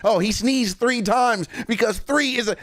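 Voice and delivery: high, excited conspiracy-fan imitation; mock fan theorist. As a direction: imitate fans breathlessly decoding a clue. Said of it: "cool shit that y'all come up with".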